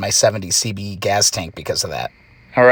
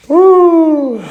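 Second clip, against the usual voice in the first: loud high whoop; excited woo; 8 dB louder than usual. Real, not acted. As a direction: let out a big happy whoop.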